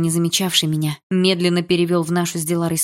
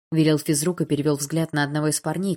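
usual speaking voice

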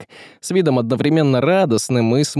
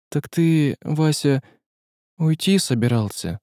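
a touch of tremble; trembling voice; 3 semitones higher than usual. A narrator's reading. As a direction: ask with shaking voice. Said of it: "Голос её дрожал".

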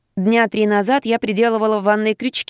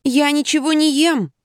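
high-pitched protest of innocence; insistent denial; 6 semitones higher than usual. A narrator's reading. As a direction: insist plaintively that it's true. Patient insists to sceptical doctor. (настойчиво оправдываться)

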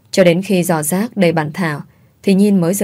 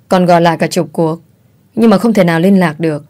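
ordinary delivery

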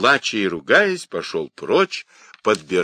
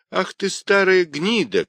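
high-pitched angry insult; boy shouting; 12 semitones higher, 3 dB louder than usual. Acted. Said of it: "кричал Юньге".

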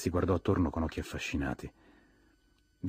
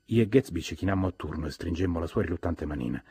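usual speaking voice

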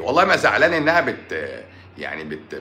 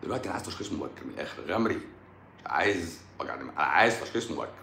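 low, much softer acted voice; mock patient request; 7 semitones lower, 9 dB quieter, faster than usual. Playing a patient who wants early diagnosis, low mock request. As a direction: drop low and soft for the patient's part.

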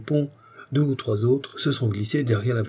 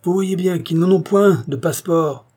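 loud, higher-pitched accusation; impatient, menacing; 8 semitones higher, 5 dB louder than usual. A narrator's reading. Armed man accuses the royal party, impatient and menacing. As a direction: accuse them with threatening impatience. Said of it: "une voix impatiente et pleine de menaces".

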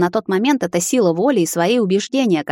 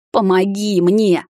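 slow, emphatic plea; pleading; 2 semitones higher, 3 dB louder, much slower than usual. A narrator's reading. Vampire begs the girl for help, slow emphatic plea. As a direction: draw the words out imploringly.

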